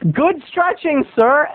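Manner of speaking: mock praise from a snotty kid. Snotty kid heckles the man stretching, cheeky. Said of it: "we were snotty kids".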